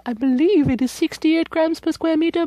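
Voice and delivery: usual speaking voice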